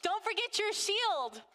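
put-on a small boy calling his mom; little son calling. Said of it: "my son comes".

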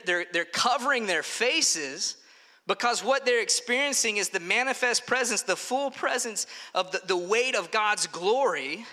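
ordinary talk, nothing marked

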